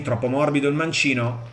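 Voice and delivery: ordinary talk, nothing marked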